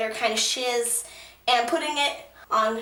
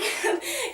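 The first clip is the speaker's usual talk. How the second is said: nervous laugh; 11 semitones higher, 3 dB quieter than usual. Real, not acted. Overwhelmed fan laughs.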